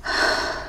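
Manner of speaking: soft, breathy sigh. Acted. Before pleading for the phone call, soft sigh. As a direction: let out a short, quiet sigh.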